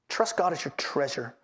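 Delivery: hushed, sincere restatement of the heading; low, soft repeat. Shown as a demonstration. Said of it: "Here's our third heading".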